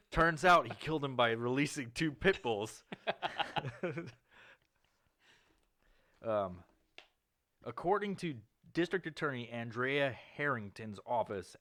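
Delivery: ordinary talk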